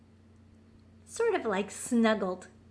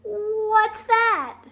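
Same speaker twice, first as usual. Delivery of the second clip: put-on high, louder eager child voice; curious little raccoon; 12 semitones higher, 8 dB louder than usual. Chester eager to hear the secret, high and loud. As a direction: blurt it like a keen small kid. Chester asks his mother.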